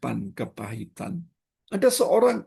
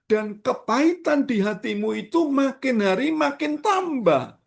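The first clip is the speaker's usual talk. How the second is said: fiery preaching; 12 semitones higher than usual. Acted. Pastor urges the congregation, preaching at full force. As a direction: lift the voice and preach it hard.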